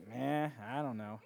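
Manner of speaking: slow, low, soft and listless; lazy shrugging reply, low and quiet; mock apathy. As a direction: drag the words out with no interest at all. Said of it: "What do you wanna do today".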